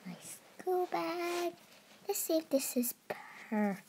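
slow whisper. Real, not acted. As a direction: hush it out. Narrator whisper aside.

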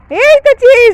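loud, high "here it is"; triumphant discovery. Acted. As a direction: burst out on finding it, loud and high. Finds chips on child's head.

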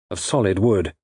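usual speaking voice

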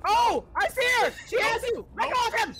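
high, loud frantic yelling. Real, not acted. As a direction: shout at the monster to stop. Yelling at the ghost.

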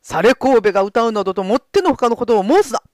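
loud, stern lord's scolding; stern rebuke. Acted. Lord rebukes Gonzo. (殿様の叱責)

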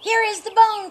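high, loud excited cry; cried out. Acted. Phoebe crying out that she found the bone, excited. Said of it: "cried Phoebe".

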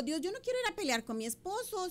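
high-pitched plea to God; retold tearful prayer. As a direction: plead upward as if starting to cry. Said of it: "empiezo a llorar".

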